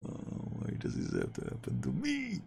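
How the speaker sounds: high, soft whiny wail; mock lament. Real, not acted. Gamer lamenting his missed penalty, high mock wail. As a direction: wail at the heavens.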